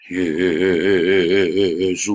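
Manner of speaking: loud, long-drawn mock prayer with a heavy wobble; pendeta berdoa. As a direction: parody a preacher praying, each vowel quivering on and on. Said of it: "Bukan si pendeta yang berdoa". (doa pendeta dibuat-buat, panjang dan bergelombang)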